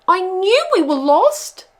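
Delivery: high, slow, loud wail. Acted. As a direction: keep wailing in despair. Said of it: "wailed Pog".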